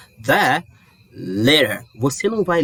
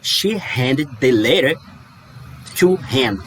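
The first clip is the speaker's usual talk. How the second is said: slow, careful English read; slow model sentence; slower than usual. Shown as a demonstration. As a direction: read it out slowly again. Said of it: "vamos ler de novo".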